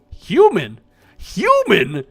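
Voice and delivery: hollering "Human?" toward someone; calling out. Real, not acted. Calling out to a stranger.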